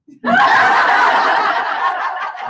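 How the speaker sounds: high, voiceless giggle; loud airy giggle; high laugh. Real, not acted. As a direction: giggle breathlessly. Laughs about the accent.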